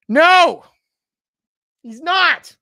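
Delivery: loud, high, incredulous outburst; exasperated shout. Real, not acted. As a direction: cry out in sheer exasperation. Exasperated at senator's question.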